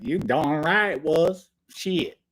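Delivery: mock Southern accent with a loud high whoop; Southern twang. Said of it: "was that a Southern twang".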